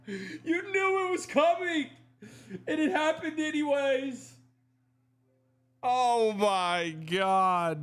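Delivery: very high, squealing, laughing disbelief; high-pitched cry of knew-it-was-coming dismay; laughing exasperation. Real, not acted. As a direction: shriek through a laugh at the inevitable. Pritchard hits the shot he expected.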